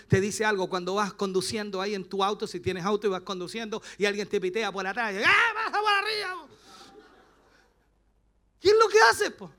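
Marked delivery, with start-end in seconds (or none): 5.2-9.5 high, strained angry yell; mock road rage. Driver to the car honking behind, mock road rage. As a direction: act out an exploding driver, pitch jumping up.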